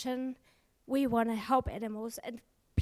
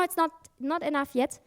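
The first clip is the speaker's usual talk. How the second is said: ordinary talk, nothing marked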